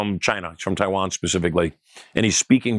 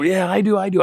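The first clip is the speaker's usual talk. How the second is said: higher, eager crowd yelling yes; eager audience reply; 10 semitones higher than usual. Retold or imitated. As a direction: play the whole crowd calling out eagerly. Audience shouting back at show.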